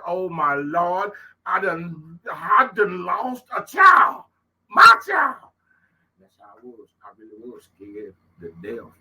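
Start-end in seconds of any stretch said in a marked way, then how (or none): 0.1-5.4 high, slow wailing shout; anguished shout. Retold or imitated. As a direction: cry out in anguish. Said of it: "I'm like".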